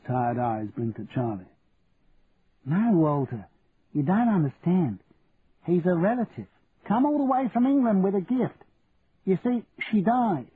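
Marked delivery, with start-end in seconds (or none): 2.6-10.5 lightly voiced elderly wife, higher, patient; old wife explaining. A narrator's reading. Wife explains the visitor is a relative, higher patient old wife voice.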